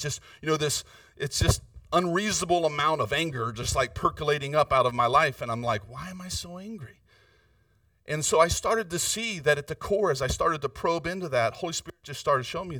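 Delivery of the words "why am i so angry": quiet self-questioning. Retold or imitated.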